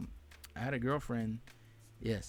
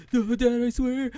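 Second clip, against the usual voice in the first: high, very loud mock wail; pleading scream, imitated; 12 semitones higher, 12 dB louder, slower than usual. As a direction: cry out as a kid begging his dad. Friend beaten by his dad, imitated.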